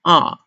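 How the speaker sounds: "a" starts high and loud, then drops; fourth-tone "a", a firm fall. Shown as a demonstration. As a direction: throw "a" downward in pitch. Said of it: "it falls".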